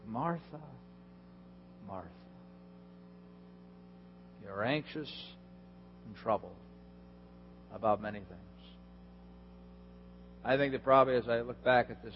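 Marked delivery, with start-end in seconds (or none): 0.1-8.4 softer, tender correction; gentle rebuke. Shown as a demonstration. Preacher voicing Jesus gently rebuking Martha, softer and tender. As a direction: lovingly call her back. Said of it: "it's a gentle rebuke".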